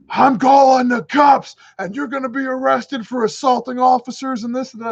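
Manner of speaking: angry pilot threatening to call the police; livid. Retold or imitated. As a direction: threaten him with the cops, furious. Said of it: "he's livid".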